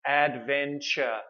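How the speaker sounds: adventure drawn out slowly, syllable by syllable; slow teacher demo of adventure; slow model word. Shown as a demonstration. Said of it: "the three syllable word".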